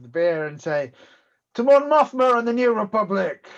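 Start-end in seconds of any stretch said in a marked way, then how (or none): 1.5-3.5 raised voice, higher and louder; rousing toast. Acted. Drinker toasting the New Republic, rousing toast. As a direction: proclaim the toast to the room. Character proposes a toast in story.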